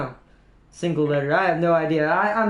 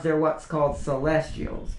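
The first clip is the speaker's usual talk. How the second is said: ordinary delivery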